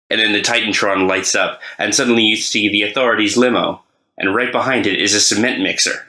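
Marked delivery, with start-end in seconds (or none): none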